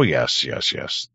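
normal talking voice

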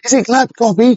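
high mock viewer's question; imitating a viewer. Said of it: "I hear you ask".

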